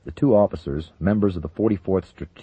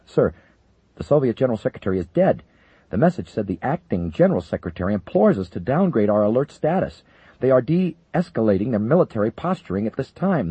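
higher, rushed urgent report; urgent, breathless; 7 semitones higher, 5 dB louder than usual. Acted. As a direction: blurt out the urgent news. Breathless aide bursts in.

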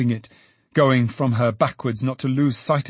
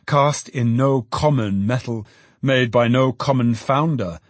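ordinary delivery, nothing marked

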